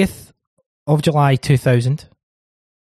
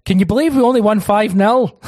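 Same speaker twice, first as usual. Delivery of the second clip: excited outburst; 8 semitones higher, 4 dB louder than usual. Retold or imitated. Dad's mocking text voiced.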